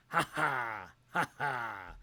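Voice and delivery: a mock wrestler's haughty "ha ha"; Jeff Jarrett laugh. Imitating Jeff Jarrett's laugh for a friend, cocky chuckle. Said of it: "he does a Jeff Jarrett where he goes".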